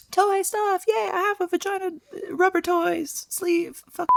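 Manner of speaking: high, bouncy product pitch; chirpy ad-girl listing; cutesy high voice. Pretend.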